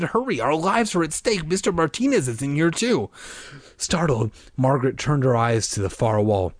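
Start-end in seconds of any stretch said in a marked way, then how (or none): 0.0-3.1 urgent, higher-pitched plea; pressing appeal in a raised pitch; pleading. A narrator's reading. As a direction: hurry them along anxiously. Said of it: "he pleaded".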